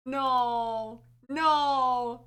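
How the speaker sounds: rising to a real shout; dismayed no-no that grows to a shout; horrified no. Real, not acted. Reacting to fox sounds.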